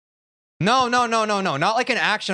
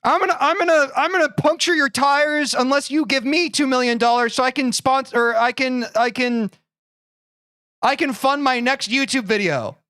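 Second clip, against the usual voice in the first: higher, excited hyped YouTuber voice; Mister Beast impression; 6 semitones higher than usual. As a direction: pitch it like a hyped YouTuber robbing you. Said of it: "how to do Mister Beast's voice".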